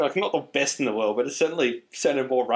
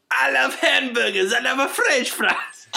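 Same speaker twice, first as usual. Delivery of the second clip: high, slow, laughing Mario Italian; hammy bad Italian; Italian accent; 11 semitones higher, 3 dB louder, slower than usual. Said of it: "I'm Italian now".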